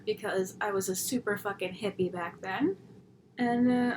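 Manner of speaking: ordinary talk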